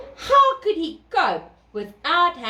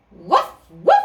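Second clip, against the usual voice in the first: loud imitated woof; barking dog; 4 semitones higher, 9 dB louder than usual. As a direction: bark like a proud dog. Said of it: "barked the dog".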